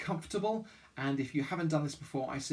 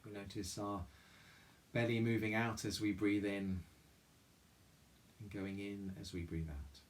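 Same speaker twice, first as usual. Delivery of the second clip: soft, calm meditation voice with long pauses; guided meditation; 3 semitones lower, 5 dB quieter than usual. Real, not acted. Chapel leader guiding listeners through mindful breathing, guided meditation. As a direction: guide them gently inward, leaving room to breathe.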